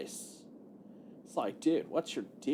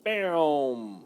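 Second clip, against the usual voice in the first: normal talking voice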